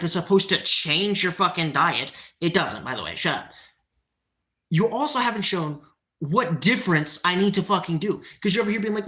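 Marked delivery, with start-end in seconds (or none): none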